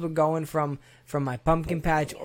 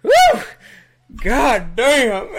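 high whoop, then a loud God damn; dad's loud disgusted exclamation; 8 semitones higher, 8 dB louder, much slower than usual. Retold or imitated. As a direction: imitate a dad hit by the stink. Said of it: "I hear the, the dad go".

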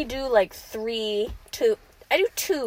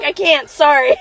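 loud, higher retold apology; mock defensive cry; 5 semitones higher, 10 dB louder than usual. Speaker retelling her answer to judges, loud mock-defensive cry. Acting out her reply to critics.

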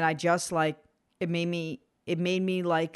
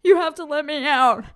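high, loud desperate plea; panicked plea; 8 semitones higher, 7 dB louder than usual. Retold or imitated. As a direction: beg loudly to be let out. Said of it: "And I was like".

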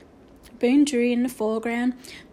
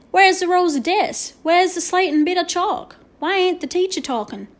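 high, loud cowboy character voice; exclaiming; 7 semitones higher, 6 dB louder than usual. A narrator's reading. Boone questioning the strange school, exclaiming. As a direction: throw out loud, puzzled questions. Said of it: "exclaimed Boone".

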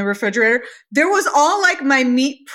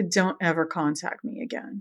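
cold dismissal; 5 semitones lower, 10 dB quieter than usual. Retold or imitated. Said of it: "I was like".

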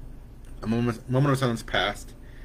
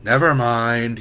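sulky; 7 dB louder, much slower than usual. A narrator's reading. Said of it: "exaggerated sigh".

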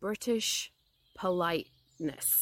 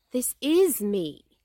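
slow, raised, puzzled protest; very surprised; 5 semitones higher, 7 dB louder, much slower than usual. A narrator's reading. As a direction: stretch it out in astonishment. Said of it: "very much surprised".

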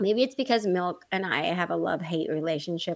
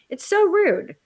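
disgusted outrage; 10 semitones higher, 5 dB louder, slower than usual. Real, not acted. Reacting to ex's habit.

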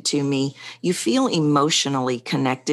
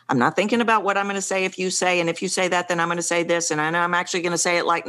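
rushed overthinking; 3 semitones higher, much faster than usual. Retold or imitated. Mimics racing overthinking in conversation.

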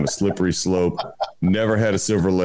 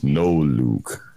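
deep, slow Vader line; low, heavy, drawn out; deep mock Darth Vader; 9 semitones lower, slower than usual. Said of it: "he goes".

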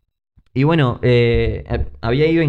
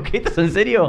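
mock surprise; 8 semitones higher than usual. Shocked at news of quake.

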